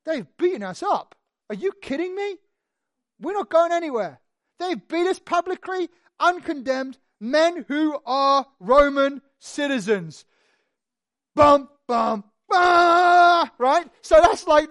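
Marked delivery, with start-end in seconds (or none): 0.1-10.2 loud, high outraged protest; indignant Paul. Acted. Paul refusing to leave prison, loud and indignant. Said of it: "And Paul said". 11.3-13.5 loud shouted dun dun dah; dramatic fanfare. Pretend. Preacher marking the twist with a loud fanfare. Dramatic sound effect after story.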